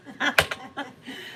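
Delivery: laughter. Real, not acted. Laughing after friend's reaction.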